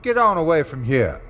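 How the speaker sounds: Smitty calling out at something scratching his door. Acted. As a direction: shout it toward the door. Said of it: "Smitty called out".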